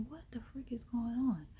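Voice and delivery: hushed, anxious whisper to herself; worried whisper. Retold or imitated. Alone at night with no reply, worried whisper. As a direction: whisper it, worried where she is.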